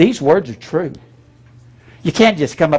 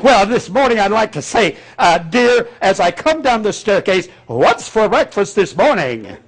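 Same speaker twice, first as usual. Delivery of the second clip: higher put-on pulpit tone for everyday words; mock preacher at home; 5 semitones higher than usual. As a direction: lightly ham the preacher voice to his wife. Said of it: "talks to his wife that way?".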